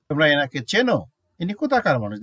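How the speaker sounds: ordinary talk, nothing marked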